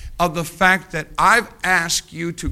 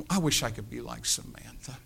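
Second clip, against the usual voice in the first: wistful sigh; 4 semitones lower, 12 dB quieter than usual. Retold or imitated. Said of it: "She sighs".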